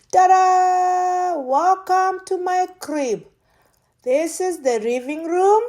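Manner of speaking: high, loud, proud showing off; excited reveal. Real, not acted. Showing viewers her new apartment, an excited reveal. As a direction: fling the door open and present it grandly.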